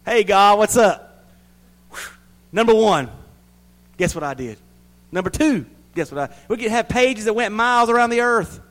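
hammed-up boastful believer. Boastful believer greeting God at heaven's gate, hammed-up and loud. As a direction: brag to God like a show-off. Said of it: "boastful soul greeting God".

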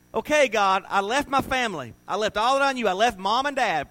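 loud, high pleading complaint to God; Abraham's complaint. Retold or imitated. Abraham pleading loudly with God about leaving family. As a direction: protest it, as a wronged man arguing with God.

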